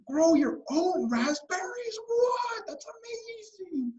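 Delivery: put-on slow, very high girl's squeal; acted child's awestruck shriek; so amazed. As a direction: stretch it out in mind-blown wonder. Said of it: "just, like, so amazed".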